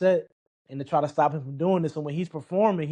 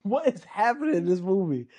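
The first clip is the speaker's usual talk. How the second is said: stunned disbelief; 7 semitones higher than usual. Retold or imitated. Retold disbelief.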